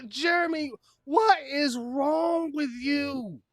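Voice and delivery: high, slow despairing cry; anguished wail. Real, not acted. Streamer wailing at the story.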